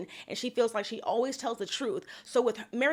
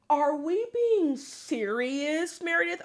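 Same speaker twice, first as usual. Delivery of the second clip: slow, drawn-out disbelief; incredulous; 4 semitones higher, slower than usual. Real, not acted. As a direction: stretch the question out in exasperation. Disbelief at Meredith's denial.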